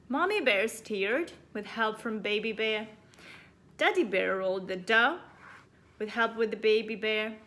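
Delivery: usual speaking voice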